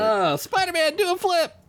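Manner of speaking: very high put-on fan call; squealed mock crowd shout; heckling onlooker. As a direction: call out high like an excited bystander. Plays onlooker calling to Spider-Man.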